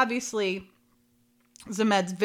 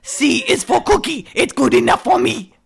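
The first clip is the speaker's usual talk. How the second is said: Cookie Monster; 5 semitones higher, 6 dB louder than usual. Retold or imitated. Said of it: "I would have done better if I would have practiced".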